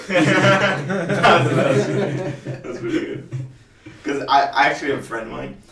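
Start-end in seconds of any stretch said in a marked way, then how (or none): 0.1-3.3 high, loud burst of laughter; laughing. Real, not acted. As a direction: laugh through the words. Laughing at the joke.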